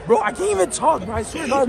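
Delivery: usual speaking voice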